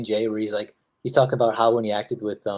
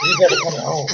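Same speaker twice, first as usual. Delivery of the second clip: higher, louder chick plea; baby bird pleading; 6 semitones higher, 5 dB louder than usual. Retold or imitated. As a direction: beg the parent bird to come home. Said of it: "the baby bird's like".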